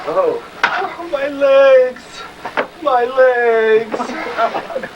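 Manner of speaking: cry of pain. Acted. Girl burned, in pain.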